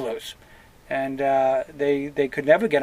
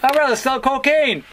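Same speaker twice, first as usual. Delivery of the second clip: defiant retort; 10 semitones higher, 4 dB louder than usual. Pretend. Same acted worker retorting.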